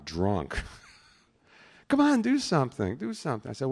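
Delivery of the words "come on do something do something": high-pitched pleading imitation; pestering guest. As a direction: imitate a guest egging you on. Retold drunk guests pestering him.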